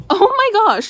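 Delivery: very loud, high-pitched exclamation of shock; startled outburst. Real, not acted. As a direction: cry out as the dog tramples your phone. Dog just stepped on her phone.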